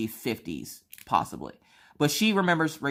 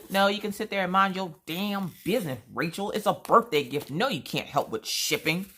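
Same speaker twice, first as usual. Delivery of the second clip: higher-pitched playful telling-off; mock scolding; 6 semitones higher than usual. Scolding a friend who offers to pay for her own gift, mock yell. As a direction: scold her without real anger. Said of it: "I have to yell at her sometimes".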